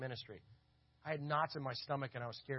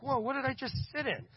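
startled; 10 semitones higher, 4 dB louder than usual. Retold or imitated. Wasps fly out of chair.

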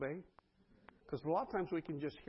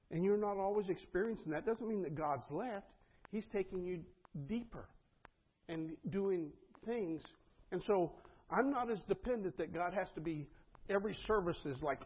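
ordinary talk, nothing marked

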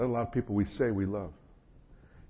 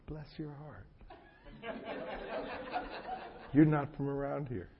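sweetly mock a Southern belle, much softer and higher, laughing; 6 semitones higher, 6 dB quieter than usual. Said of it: "beautiful Southern belle who went".

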